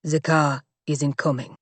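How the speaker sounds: low, grim flat statement; grim bodyguard. Acted. Bodyguard tells them the car is not coming, low and grim. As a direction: deliver bad news bluntly. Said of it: "he said".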